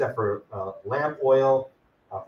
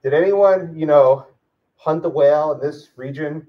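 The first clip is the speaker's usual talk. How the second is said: raised voice, asking round the whalers; calling out; 3 semitones higher, 9 dB louder, slower than usual. Acted. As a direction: put a question loudly to a crowd. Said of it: "You could have asked around, said".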